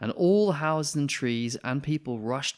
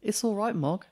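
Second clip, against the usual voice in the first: put-on soothing girl; 11 semitones higher than usual. Debbie soothing the cat.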